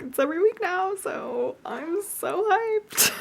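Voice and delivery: high, drawn-out thrilled squeal; excited. Real, not acted. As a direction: gush with delight. Thrilled about weekly Zumba class.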